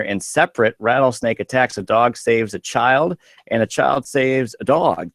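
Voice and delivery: normal talking voice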